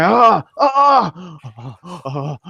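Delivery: high, loud yelps fading off; mock pained cries. Player slapping at burning eyes, mock pained cries. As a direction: yelp as if your face is scorched.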